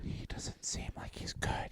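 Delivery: unvoiced whispered aside. Real, not acted. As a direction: whisper it conspiratorially.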